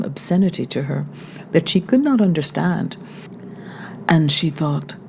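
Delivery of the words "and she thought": ordinary delivery, nothing marked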